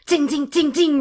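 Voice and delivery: high, ringing dings; imitated bullet hits. A narrator's reading. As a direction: make bullets ping off stone.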